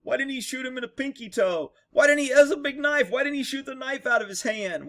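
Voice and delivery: imitating know-it-all people; mocking naive critics. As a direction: mimic clueless armchair critics. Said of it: "People are like".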